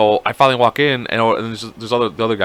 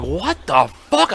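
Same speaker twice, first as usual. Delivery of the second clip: slow, higher drawn-out bewilderment; stunned disbelief; 6 semitones higher, much slower than usual. Retold or imitated. Teller replaying the reaction on leaving the store, stunned disbelief. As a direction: stretch it out, baffled.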